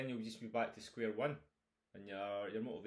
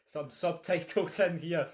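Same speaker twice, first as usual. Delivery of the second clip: laughing; 8 semitones higher, 11 dB louder than usual. Real, not acted. Laughs at own joke.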